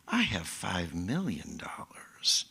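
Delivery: slow, low stage whisper; secretive whisper. Acted. Robbed person remembering a hidden fortune, secretive whisper. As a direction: whisper it slowly like a delicious secret.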